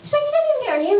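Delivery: high, incredulous question; a touch of mock outrage; shocked mum. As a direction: squeak it in disbelief.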